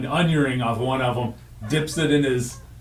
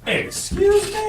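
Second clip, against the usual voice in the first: very high, slow outraged "excuse me"; shocked; 3 dB louder, slower than usual. Real, not acted. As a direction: shriek it in disbelief. Shocked at insult to gravy.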